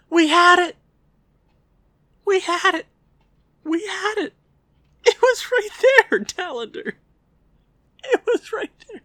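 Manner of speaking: high, strained cries of lost victory; anguished wail. Real, not acted. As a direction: wail that the win slipped away.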